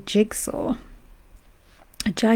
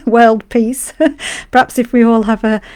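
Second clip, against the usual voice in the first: louder, giggling through the words; laughing; 8 dB louder than usual. Real, not acted. Streamer joking about cake and world peace, laughing. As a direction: break into real laughter while talking.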